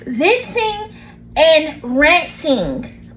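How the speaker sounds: slow, deliberate VENTING and RANTING; careful word-sorting. Real, not acted. Host untangling two words for listeners, slow and deliberate. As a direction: stretch each word to get it straight. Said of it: "still trying to put it together".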